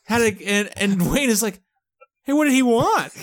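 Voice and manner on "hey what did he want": higher, louder mock-innocent question; feigned innocence. Wayne asking what Alan wanted, feigning innocence. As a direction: play dumb, raised pitch.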